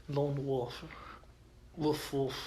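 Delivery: a lightly sultry cashier flirting; hint of a seductive purr, barely put on; sexy voice. Cashier flirts with the man in the Lone Wolf cap, mildly sultry. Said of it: "in a very sexy voice".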